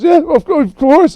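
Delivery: high, loud frightened yes; nervous agreement. Acted. David hastily agrees with Moira, high and loud. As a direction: stammer a scared agreement. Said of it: "And David says".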